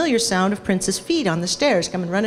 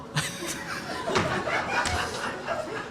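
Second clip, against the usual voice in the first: squeaky silent laugh; high breathless laugh; 11 dB quieter than usual. Real, not acted. Laughing at own story.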